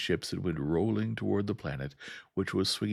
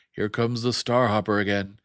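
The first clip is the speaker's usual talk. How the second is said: loud, higher alarmed shout; yelling; 5 semitones higher, 9 dB louder than usual. A narrator's reading. Crewman yelling a warning across the bridge, loud. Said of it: "somebody else was yelling".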